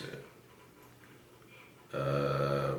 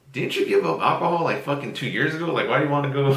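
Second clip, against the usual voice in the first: much higher and louder, incredulous; mock incredulous; 9 semitones higher, 7 dB louder, faster than usual. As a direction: fire it back in loud disbelief. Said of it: "And I'm like".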